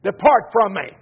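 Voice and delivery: loud, stern banishing order; stern loud command. Acted. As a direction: cast them out with force. God voiced rejecting the sinner.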